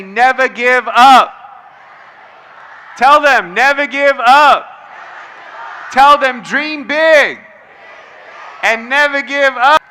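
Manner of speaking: loud, punchy crowd call; rallying shout. Real, not acted. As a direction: pump the audience up. Rallying crowd to tell neighbours.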